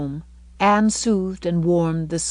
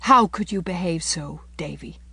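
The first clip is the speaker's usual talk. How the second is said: mild, reproachful sorrow; gently pained question, sinking at the end; sorrowful. A narrator's reading. Anne questions misbehaving Davy, sorrowful. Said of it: "she asked sorrowfully".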